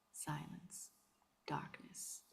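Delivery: hushed, breathy whisper. A narrator's reading. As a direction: barely breathe the words. Lights go out, deathly silence.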